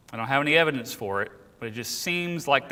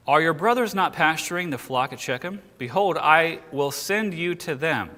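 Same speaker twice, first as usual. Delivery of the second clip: raised, deliberate verse reading; scripture reading; 5 semitones higher, 4 dB louder than usual. Acted. Israel sending Joseph to his brothers, scripture reading. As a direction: read it out as a formal pronouncement.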